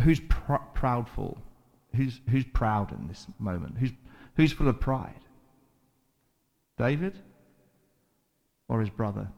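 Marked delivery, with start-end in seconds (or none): none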